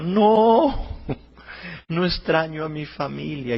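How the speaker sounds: acted wail, loud and high; storyteller's high, loud crying protest; tearful denial. A narrator's reading. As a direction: cry out the opening, then ease down. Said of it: "Y seguía llorando Anita".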